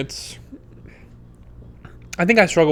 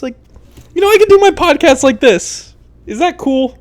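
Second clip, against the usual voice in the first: excited; 12 semitones higher, 8 dB louder than usual. Real, not acted. Lying on couch showing off.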